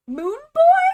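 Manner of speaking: very high, loud squeak of disbelief; shocked question. Real, not acted. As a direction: pitch shooting way up into a loud question. Repeats the baffling name in disbelief.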